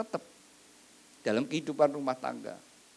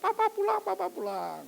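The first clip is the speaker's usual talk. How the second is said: put-on much higher joyful child cry; happy child shout. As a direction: squeal with delight that daddy's home. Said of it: "anak itu, wah, teriak". (anak berteriak gembira menyambut papa pulang)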